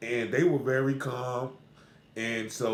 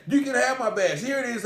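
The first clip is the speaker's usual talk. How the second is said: defiant bailiff; 9 semitones higher, 3 dB louder than usual. Retold or imitated. Bailiff defiant about his badge.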